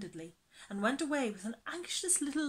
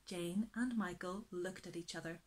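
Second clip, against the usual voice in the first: plain reading voice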